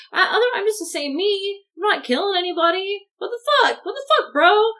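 high, aggrieved pleading; mock exasperated protest. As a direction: act out an incredulous, wounded complaint. Acting out Jacob's exasperated plea.

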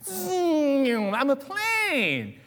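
very high, slow, drawn-out plane voice; plane character. Acted. Skit actor playing a plane, high and slow. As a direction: stretch it out like a plane in flight. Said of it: "he's like".

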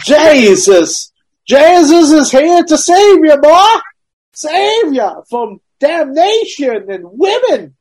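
loud hammed-up revival sermon; mock fiery preacher. Preacher saving boy from damnation, loud mock preaching. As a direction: holler salvation like a tent preacher.

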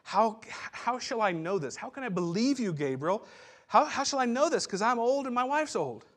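rising, pleading doubter; whiny doubting Zechariah. Retold or imitated. Old priest pleading with the angel, mock whiny. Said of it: "That he goes".

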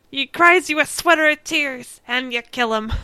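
a hint of a gruff sea captain, voiced loosely; Mister Krabs sailor accent. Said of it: "that was my sailor accent".

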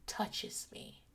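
breathy hushed retelling; hushed storyteller whisper. Retold or imitated. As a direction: drop to a whisper for suspense. Dramatic retelling of sneaky touch.

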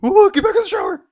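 high, loud, teasing squeal; high mock-sexy voice. Mock-flirty voice from the show.

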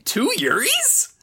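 very high, slow, wondering; high drawn-out question. Pretend. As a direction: stretch it out up high.

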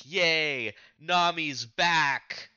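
high, slow mock cheering. Podcaster voicing the crew greeting Nami, high mock cheer. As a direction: cheer like a happy crew welcoming her home. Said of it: "and everyone's like".

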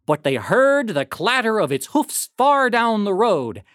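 plain reading voice

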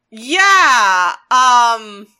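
a sudden loud Yeah, then trailing off; loud mock answer. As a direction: blurt it out loudly as Ironhide. Ironhide answers Megatron's question.

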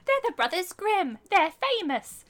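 put-on high, eager kid's voice; posh little child. The child tells his papa who the heroes are, high and eager. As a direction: boast like a proud little boy. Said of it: "some little Lord Fauntleroy child is like".

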